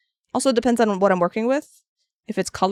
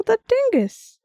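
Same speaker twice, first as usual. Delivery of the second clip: much higher, insistent grandma; imitating grandma; 13 semitones higher, 4 dB louder, slower than usual. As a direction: repeat the word as if it's obvious. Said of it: "She's like".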